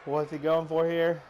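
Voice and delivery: excited count and call; rising play-by-play excitement; wrestling commentator. Acted.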